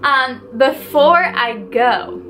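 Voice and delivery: normal talking voice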